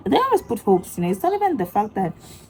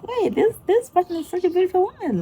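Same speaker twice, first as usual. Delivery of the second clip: awed gushing; 12 semitones higher than usual. Retold or imitated. Gushing over TikTok woman.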